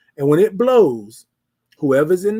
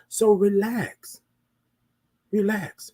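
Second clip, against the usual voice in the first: slow relax; 2 semitones higher, slower than usual. Shown as a demonstration. Slowed mantra.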